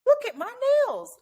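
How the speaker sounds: high, delighted "look at my nails"; excited showing off. Retold or imitated. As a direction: gush over new nails. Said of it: "I said".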